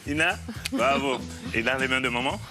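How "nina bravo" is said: usual speaking voice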